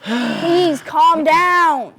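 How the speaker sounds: loud, very drawn-out order; exasperated shout. Acted. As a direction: yell it slowly to stop the argument. Anchor shouts down arguing guest.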